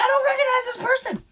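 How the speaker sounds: high, fast, half-laughing alarm; rising panic at a stranger. Retold or imitated. Panicked thoughts at intruder.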